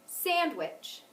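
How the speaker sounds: accent the first syllable of Sandwich; stress on first syllable. Shown as a demonstration. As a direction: punch the Sand in Sandwich, let wich fall. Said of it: "that one was on the first".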